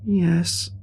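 put-on high, small child's voice; timid high one-word answer; frightened little girl. A narrator's reading. Frightened girl answering the officer, small high voice.

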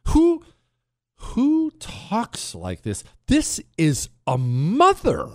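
high, drawn-out incredulous question; appalled disbelief. Real, not acted. Radio host reacting to a played clip, appalled disbelief.

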